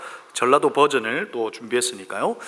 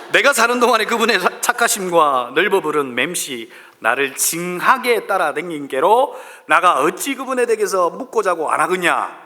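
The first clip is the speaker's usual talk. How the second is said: Jeolla dialect reading; 4 semitones higher, 3 dB louder than usual. Acted. Pastor reads Psalm 23 to a laughing congregation in Jeolla dialect. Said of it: "전라도 버전이에요".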